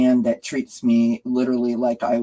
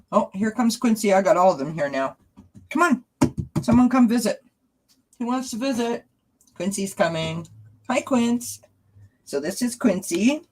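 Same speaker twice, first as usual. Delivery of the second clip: much higher coaxing voice for the cats; pet talk; 8 semitones higher than usual. Real, not acted. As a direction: sweet-talk the cat to come up. Greeting cats on camera.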